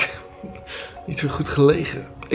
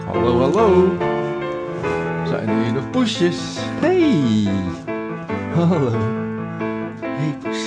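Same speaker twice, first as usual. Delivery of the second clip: pet talk; 2 semitones higher than usual. Real, not acted. Calling the cats in pet voice.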